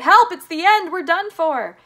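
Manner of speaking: very high, loud cry for help; panicked shout. A narrator's reading. Grandpa Joe as the elevator crashes through, panicked shout. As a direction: yell as if doomed. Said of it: "Grandpa Joe shouted".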